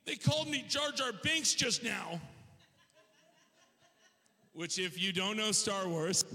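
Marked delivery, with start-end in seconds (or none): 0.1-2.2 very high, mock-tearful child wail; hysterical little boy. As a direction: imitate a sobbing five-year-old tattling. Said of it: "he's hysterical".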